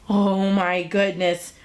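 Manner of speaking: usual speaking voice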